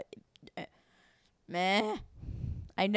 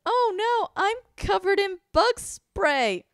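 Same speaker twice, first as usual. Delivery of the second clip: high, slow mock excuse; high-pitched pretend alarm; fake excuse; 11 semitones higher, 4 dB louder, slower than usual. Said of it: "'Cause I was like".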